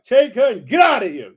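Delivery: loud, commanding read-aloud order; acted king's booming dismissal; shouted dismissal. A narrator's reading. Pharaoh angrily dismisses Abram.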